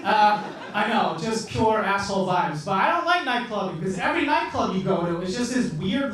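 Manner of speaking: usual speaking voice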